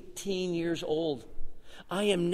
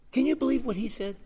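high mock gossip, raised; catty gossip voice; 9 semitones higher than usual. Church gossip mocking the preacher, catty.

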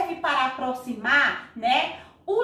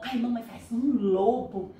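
ordinary talk, nothing marked

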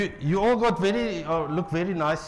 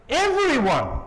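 much higher, ringing cry; proclaiming call; 9 semitones higher, 3 dB louder, slower than usual. Acted. Prophet calling out to everyone, much higher. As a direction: call out to all people. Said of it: "And he goes".

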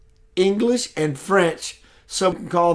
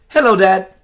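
loud phone greeting; 7 dB louder than usual. Real, not acted. Greets father on phone call.

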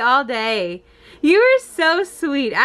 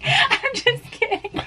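laughing; 8 semitones higher, 3 dB quieter than usual. Real, not acted.

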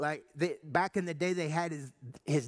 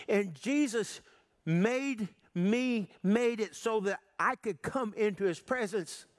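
preaching; 5 semitones higher than usual. Acted. Preacher driving home the debt Jesus paid, fervent preaching. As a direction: preach it to the congregation with conviction.

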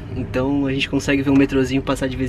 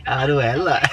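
put-on Italian accent Portuguese; 2 semitones lower, slower than usual. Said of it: "mas fala em sotaque italiano".

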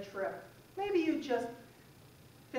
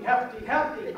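many voices in unison; 9 dB louder than usual. Shown as a demonstration. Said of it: "and y'all say it with me".

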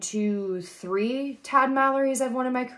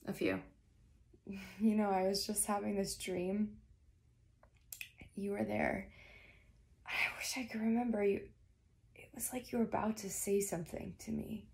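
very soft, half-whispered; weak, intimate murmur; 11 dB quieter than usual. Acted. Patient recalling a dream to the doctor, murmuring. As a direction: speak softly, as if just waking.

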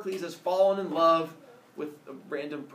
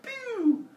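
arrow pew sound; 5 dB louder than usual. Acted.

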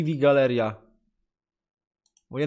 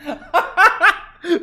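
loud high laugh burst; 11 semitones higher, 6 dB louder than usual. Real, not acted. Cracking up at a video.

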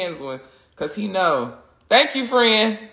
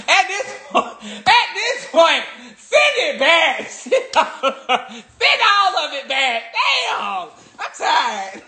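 laughing exasperation; 10 semitones higher, slower than usual. Real, not acted. Laughing, exasperated at more packages.

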